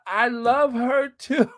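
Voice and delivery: high, gushing words breaking into a laugh; gush, then spill over into high laughter. Real, not acted. Breaks into laughter agreeing with viewer.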